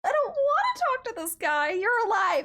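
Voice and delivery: whiny dread. Real, not acted. Dreading the creepy classmate.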